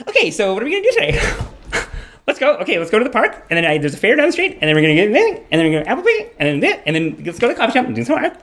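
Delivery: put-on high, fast, bubbly girlfriend voice; cheerful girlfriend. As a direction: rattle off the day's plans brightly. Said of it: "she was like".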